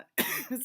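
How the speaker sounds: short real cough; one dry cough. Real, not acted. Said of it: "I still cough too".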